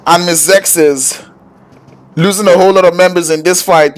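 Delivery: high-pitched, urgent esports fight call; excited play-by-play. Real, not acted.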